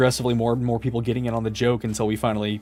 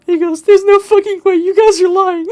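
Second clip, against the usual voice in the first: very high, loud squeal through laughter; helpless laughter; 8 dB louder than usual. Real, not acted. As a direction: keep telling it while cracking up. Cracking up while telling story.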